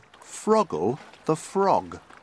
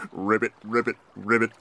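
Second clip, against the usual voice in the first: frog croak; faster than usual. A narrator's reading. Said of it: "said Zack the Duck".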